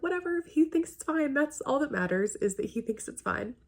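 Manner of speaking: high-pitched, shrugging concession; grudging dismissal. Retold or imitated. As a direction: give in with a high, dismissive lilt. Grudgingly conceding her point to the film.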